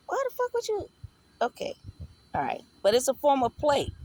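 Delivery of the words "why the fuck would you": mock outrage, voice jumping up; exasperated disbelief. Questioning her soulmate about what he did, high exasperated disbelief.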